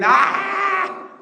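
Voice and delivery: high, loud startled shriek of fright; terrified scream. Acted. Disciples on the boat seeing a ghost, terrified scream. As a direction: scream like you just saw a ghost.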